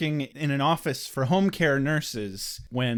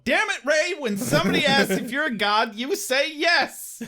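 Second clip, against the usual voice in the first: exasperated scolding; 11 semitones higher, 3 dB louder than usual. Acted. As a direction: chide him with mock exasperation. Mock scolding quoted character.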